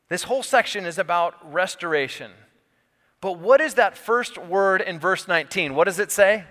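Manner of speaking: preacher building toward the key word; rising preaching. Real, not acted.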